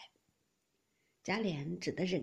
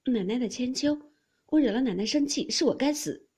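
high, loud self-blame; raised, pleading apology; contrite plea; 4 semitones higher, 7 dB louder than usual. A narrator's reading. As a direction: beg forgiveness earnestly from a mistress. Maid kowtows, begging mistress's forgiveness.

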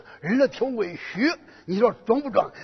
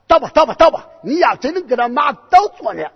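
raised voice, daring him; loud dare; 3 semitones higher, 7 dB louder, faster than usual. Acted. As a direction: egg the boy on at full voice. Character dares boy to topple horse.

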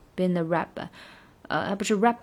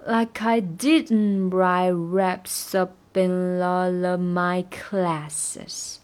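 very slow, each word apart; broken down slowly; much slower than usual. Shown as a demonstration. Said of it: "拆开的话就是". (慢速拆开)

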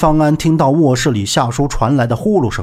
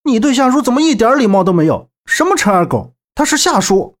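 high, quick, indignant scolding; displeased; 9 semitones higher than usual. A narrator's reading. Young man defending the uncle's name, high and annoyed. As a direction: scold him for his rudeness. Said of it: "心情大不爽地说道".

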